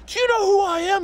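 hammed-up entitled rock star; arrogant mock shout. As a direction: demand it like an offended star. Rock star demanding recognition, retold.

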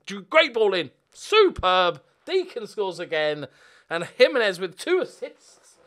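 loud, high excited shouting; real elated commentary yell; goal celebration. Real, not acted.

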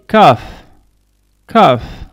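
"calf" said with no L sound; silent L. Shown as a demonstration. English teacher models calf for French speakers, silent L. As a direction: say "calf" loudly, leaving the L silent. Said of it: "On ne prononce pas le L".

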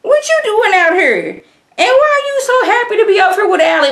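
high, loud disbelief with a laugh; incredulous sass. Retold or imitated. Viewer confronts Beverly, high loud and laughing. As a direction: demand answers with laughing outrage. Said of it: "I'm like".

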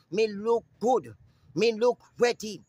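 usual speaking voice

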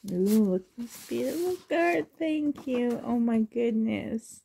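normal talking voice

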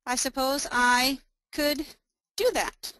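halting, a few words at a time; broken up by long pauses; slow typing dictation. Real, not acted. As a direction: say it while typing it out. Reading aloud while typing dialogue.

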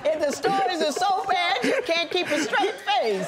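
laughing through the words; can't keep a straight face. Real, not acted. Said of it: "can't stop laughing".

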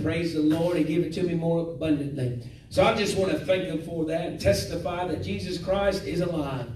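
ordinary talk, nothing marked

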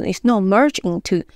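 ordinary delivery

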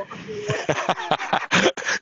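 a short surprised burst of laughter, high and airy; amused laugh. Real, not acted. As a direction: laugh at being caught out by the poster. Caught out by the poster remark.

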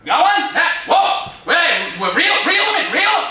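high, loud, urgent cheering-on; real thrilled fishing excitement; excited coaching. Real, not acted. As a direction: shout urgent instructions to someone landing a fish. Coaching someone reeling in a fish.

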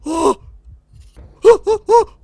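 high, loud panicked screaming; scared screams. Real, not acted. Gamer hit by a jump scare, screaming in real fright.

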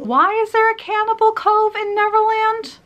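very high, squeaky disbelief. Pretend. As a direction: squeak it out in shock. High inserted reaction clip.

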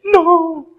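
high, loud tearful no; sobbing no. Retold or imitated. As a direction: choke the word out in tears. Son sobbing on phone. (un no agudo entre sollozos)

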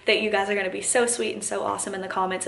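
ordinary delivery

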